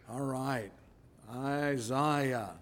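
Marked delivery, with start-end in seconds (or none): 1.3-2.5 Isaiah drawn out the English way, eye-ZY-uh; English pronunciation. Shown as a demonstration. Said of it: "as the English say".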